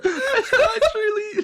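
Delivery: high squeaky laugh; words lost in laughter. Real, not acted. Both laughing uncontrollably.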